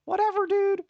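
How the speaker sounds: high-pitched mock voice of a clueless public; mocking society. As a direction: lampoon careless people in a silly high voice. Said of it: "You were like".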